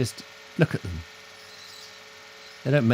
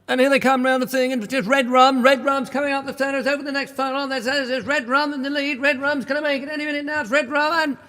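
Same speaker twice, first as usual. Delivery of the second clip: high, fast mock race call; hammed-up horse race commentator; racing commentator; 4 dB louder than usual.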